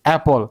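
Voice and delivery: "অ্যাপল" opening with a short "a" as in cat; correct short vowel. Shown as a demonstration. Teacher correcting apple for learners, with a short a sound. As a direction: say "অ্যাপল", first vowel short and open, no glide. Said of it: "আমাদের উচ্চারণ হচ্ছে".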